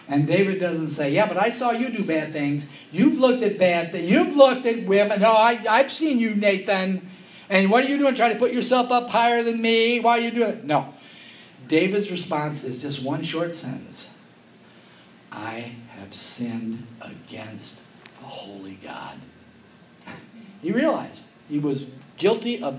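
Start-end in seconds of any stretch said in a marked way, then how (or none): 1.1-10.6 higher, louder indignant comeback; mock defensive retort. Imagined defensive retort from David. 15.3-19.2 slow, hushed whisper; David whispering his sin; whispered confession. Acted.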